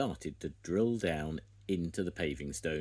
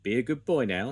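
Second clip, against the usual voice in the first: louder, raised coaxing voice; gentle order to dog; 5 semitones higher, 8 dB louder than usual. A narrator's reading. Farmer Pickles telling Scruffy to be good, loud. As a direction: coax the dog to behave. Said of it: "said Farmer Pickles".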